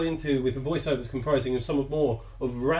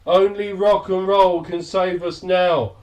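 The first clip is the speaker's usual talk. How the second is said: quavering treble; 5 semitones higher, 7 dB louder than usual. Retold or imitated. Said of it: "in a quavering treble".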